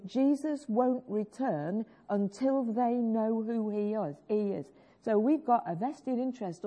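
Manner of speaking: usual speaking voice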